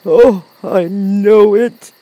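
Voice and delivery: loud, drawn-out high wail; ashamed lion. A narrator's reading. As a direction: wail the admission as a shamed lion. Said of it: "hanging his head in shame".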